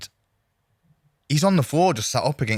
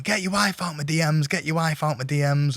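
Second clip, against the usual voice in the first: mild mock yell; 6 semitones higher than usual. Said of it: "kind of shouting".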